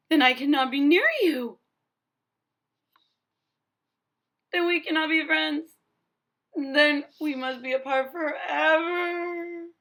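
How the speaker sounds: dramatic storybook heartbreak; mock despair. Gerald telling Piggy they must part, nearly crying. Gerald fears losing his friend.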